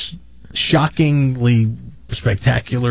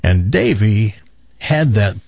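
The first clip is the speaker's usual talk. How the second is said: ordinary talk, nothing marked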